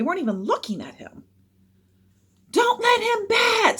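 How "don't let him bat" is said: very high, loud, slow shout; strained, hostile holler; heckler shouting. A narrator's reading. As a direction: shout in protest from the crowd. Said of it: "someone shouted".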